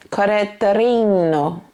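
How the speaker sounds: put-on Italian accent with a tapped r; fake Italian accent. Reviewer playfully retrying the Italian fragrance house's name, fake Italian accent. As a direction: draw it out in a mock Italian accent. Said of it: "my fake Italian accent".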